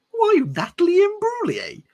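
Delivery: hammed-up sob; mock wail.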